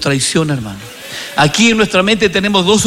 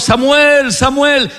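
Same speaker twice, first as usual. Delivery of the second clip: calling voice; 7 semitones higher, slower than usual. Acted. God's voice calls young Samuel.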